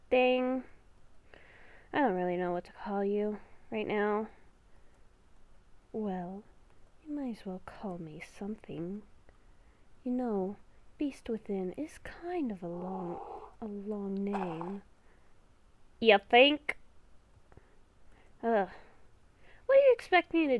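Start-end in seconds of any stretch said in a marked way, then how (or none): 5.9-14.8 beast within voice. Acted. Inner beast whispering a warning to Haley, hushed and ominous. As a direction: whisper like a creature living inside her head.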